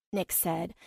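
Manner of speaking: usual speaking voice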